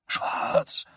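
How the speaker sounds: throaty. Acted. Dazed boy after his fall, answering in a throaty whisper. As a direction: force the word out from the throat. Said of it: "brachte Johnny kehlig heraus".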